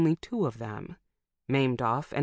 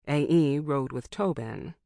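plain reading voice

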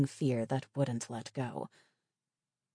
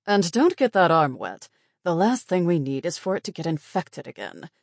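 stern warning; 3 semitones higher, 6 dB louder than usual. Acted. The nurse added.

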